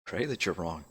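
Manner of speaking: soft, ominous; grim warning. Acted. Elrin warns Ren about her haunting, quietly grim.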